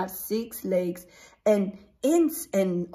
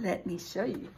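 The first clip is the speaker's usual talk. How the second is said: normal talking voice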